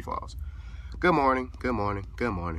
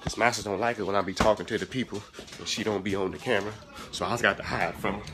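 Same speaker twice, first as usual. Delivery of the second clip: confiding servant; 2 semitones lower than usual. Pretend. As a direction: confide in the audience behind the boss's back. Servant confides in audience secretly.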